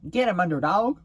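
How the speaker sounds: loud, high-pitched Texas holler; Texas accent. Pretend. Vlogger playing around for viewers, hollering in Texas accent. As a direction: holler it out in a hammed-up Texas twang. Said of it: "I'm using a Texas accent".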